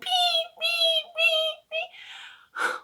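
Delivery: high, held electronic beeps; high thin mock beeping; imitated machine beep. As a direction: mimic the cart's beeping. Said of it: "that cart the whole time is going beep".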